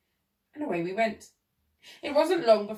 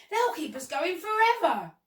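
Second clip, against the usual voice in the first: high-pitched exclamation; excited cry; 9 semitones higher, 4 dB louder than usual. A narrator's reading. Monkey delighted by so much work, excited cry. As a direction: crow over it in a high voice. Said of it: "cried the monkey".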